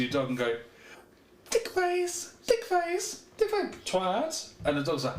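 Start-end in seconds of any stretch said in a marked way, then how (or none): 1.5-4.5 very high, gleeful dog-owner squeal; sweet dog-praising tone. Shown as a demonstration. Owner insulting a dog in a happy tone, high cooing. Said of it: "tone of the voice".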